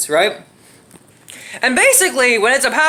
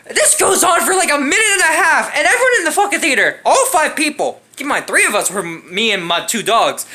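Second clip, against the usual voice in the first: usual speaking voice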